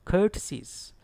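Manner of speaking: courtesies with COUR high and stressed, said "kur"; high-pitched first syllable in courtesies. Shown as a demonstration. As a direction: punch the KUR in courtesies, then fall. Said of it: "Stress is on C O U R".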